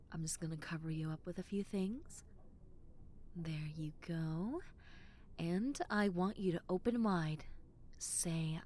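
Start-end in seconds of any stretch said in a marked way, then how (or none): 0.1-4.6 soft whispered care. Acted. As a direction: whisper close as you cover them. Covering patient, intimate whisper.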